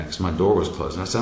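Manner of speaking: plain reading voice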